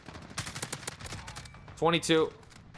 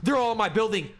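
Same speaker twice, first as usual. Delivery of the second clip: alarmed; 9 semitones higher, 4 dB louder than usual. Real, not acted. Enemies swarming his building.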